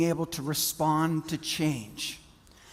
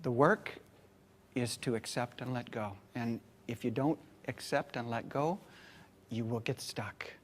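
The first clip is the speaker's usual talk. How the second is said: softer, earnest advice; serious; 6 semitones lower, 10 dB quieter than usual. Retold or imitated. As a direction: lower and quieter, sincere counsel to the crowd. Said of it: "I said to them very seriously".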